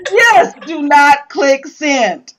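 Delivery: loud, high, drawn-out warning; slow it down and belt it out; emphatic agreement. Real, not acted. Agreeing with co-host about angry emails, emphatic.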